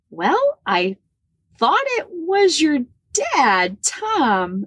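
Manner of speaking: high, drawn-out, unsure reply; hesitant answer. Retold or imitated. Aunt answering her niece about the father, high hesitant drawl. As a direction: stretch it out high, as if stating the awkwardly obvious.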